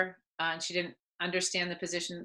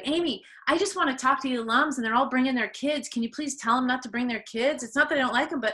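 higher-pitched, imitated plea; pleading; 6 semitones higher than usual. Former player begging her coach about the alumni's kids, imitated pleading. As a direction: retell it as an earnest, wheedling request.

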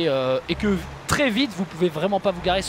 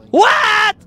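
shocked what; 12 semitones higher, 8 dB louder than usual. Real, not acted. Shocked at something in game.

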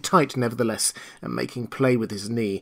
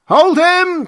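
much higher, louder shout; crowd cry; 10 dB louder than usual. Acted. Villagers all at once, loud high cry. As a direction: yell it as the whole crowd. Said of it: "cried everyone".

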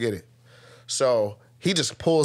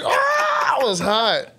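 very high shouted cry; hammed-up outburst; mock rage shriek; 10 semitones higher, much slower than usual. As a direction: shriek with boiling anger.

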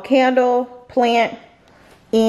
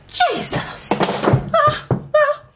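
winded gasp; much slower than usual. Real, not acted. Out of breath after stairs.

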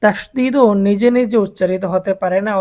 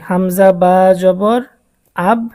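slow spelled-out demo; 3 dB louder, much slower than usual. Shown as a demonstration. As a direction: slowly spell out এই হামজা বা জবর আব syllable by syllable. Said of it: "যদি আমরা এটা ভেঙে লিখি".

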